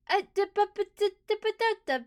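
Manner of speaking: loud high flustered babble; excited babbling. Real, not acted. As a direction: sputter nonsense in disbelief. Stammering in disbelief.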